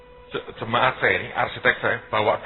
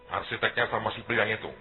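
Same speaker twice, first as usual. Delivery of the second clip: ordinary talk, nothing marked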